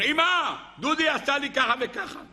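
put-on high-pitched whining tattletale child; child's mock scream. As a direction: cry out to mom like a wronged three-year-old, not truly screaming. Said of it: "ואז הוא צרח". (צווחה מעושה של ילד מתלונן)

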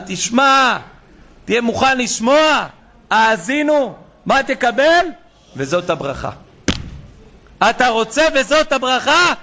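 loud, rising sermon build; fiery preacher climax; preaching. Acted. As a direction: preach it hard to the crowd. Rabbi preaching at full force.

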